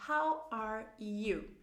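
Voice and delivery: very slow, drawn-out English model; slow model phrase. Shown as a demonstration. Teacher models the question for learners, slowly drawn out. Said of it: "exercícios de falar em voz alta".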